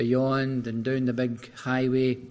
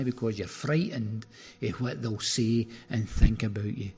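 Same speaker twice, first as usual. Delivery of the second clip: usual speaking voice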